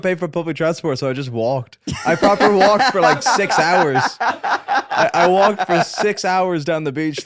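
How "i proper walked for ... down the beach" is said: laughing. Real, not acted. Telling of walking six hours.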